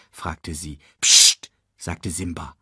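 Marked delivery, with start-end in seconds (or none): 1.0-1.5 loud voiceless psst; forceful hushing hiss; sharp shush. Acted. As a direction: shush a friend urgently. Simba shushes Nala, plotting secretly.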